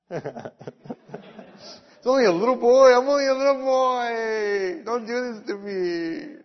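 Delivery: put-on high, loud, laughing child's whine; hammed-up pleading little boy; whiny little boy. Boy begs aunt not to make him ride, high whining plea.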